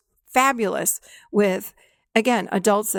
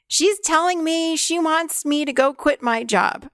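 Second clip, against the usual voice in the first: high, drawn-out mock adolescent; 6 semitones higher, slower than usual. Group client reporting a supposed inner-child demand, mock adolescent voice. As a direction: mimic a client passing on a bossy demand. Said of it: "that's the adolescent".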